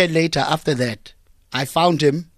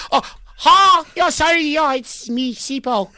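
fake Model C accent; 10 semitones higher than usual. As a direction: switch on an exaggerated Model C accent. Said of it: "before the accent kicks in".

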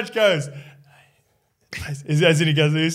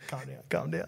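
very soft, under-the-voice urging; imitated discreet hushing; 16 dB quieter than usual. Referee telling the player to settle, imitated near-silent urging. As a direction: mimic someone quietly settling a mate down. Referee quietly calming Mace, retold.

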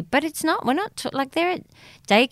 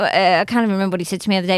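usual speaking voice

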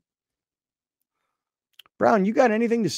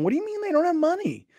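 incredulous guy; 13 semitones higher, 4 dB quieter than usual. Retold or imitated. Voicing an incredulous driver.

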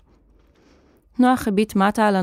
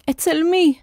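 high, despairing cry; desperate, pleading question; voice breaking; 8 semitones higher than usual. A narrator's reading. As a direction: call out in despair, voice cracking. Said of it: "קרא נוח וקולו נשבר בייאוש".

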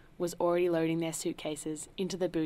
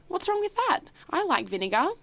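much higher, loud defensive protest; indignant; 12 semitones higher, 6 dB louder than usual. A narrator's reading. Host defending his vinegar to the butler, high and indignant. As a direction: bristle and defend your taste.

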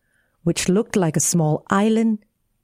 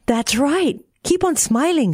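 high, cheering-on voice; encouraging; 6 semitones higher than usual. A narrator's reading. Frog to Tearful as pond shrinks, high encouragement. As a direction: urge it along, pitched up.

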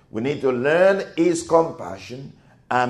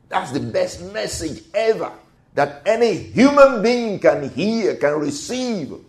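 ordinary talk, nothing marked

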